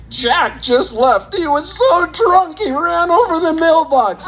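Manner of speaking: high, tipsy party-guest gossip. Retold or imitated. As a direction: dish it like a giggly sixties partygoer. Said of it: "They go".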